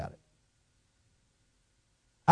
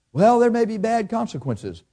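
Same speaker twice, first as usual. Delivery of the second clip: higher, worried objector; anxious objection; 7 semitones higher than usual. Retold or imitated. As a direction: raise the fear like a nervous member. Imitating fearful listener.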